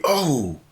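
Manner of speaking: high startled oh, falling; mock cry of being struck; acted "oh" of impact. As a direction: cry out as if a wave hits. Hit by the wave, retold.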